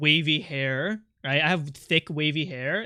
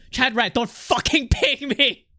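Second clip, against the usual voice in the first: half-laughing yell; 6 semitones higher, 3 dB louder, slower than usual. Real, not acted. Streamer snapping at a chatter.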